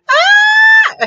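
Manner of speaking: long piercing scream; grito liberador. Real, not acted. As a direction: let out a very high, loud scream. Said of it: "cuando tú estás gritando". (grito agudísimo y fuerte)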